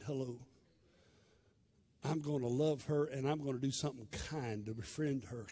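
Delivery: soft answer. Acted. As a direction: answer the Lord quietly, yielding. Answering God humbly.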